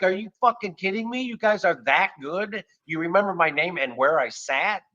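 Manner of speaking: high, incredulous questioning; delighted disbelief. Retold or imitated. Customer to restaurant staff who remembered him, amazed. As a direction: react amazed, drawing out the praise.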